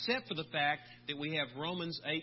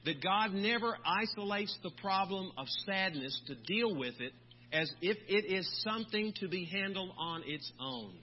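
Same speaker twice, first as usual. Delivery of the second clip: usual speaking voice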